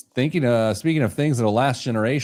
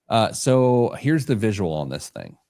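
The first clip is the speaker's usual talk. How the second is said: ordinary talk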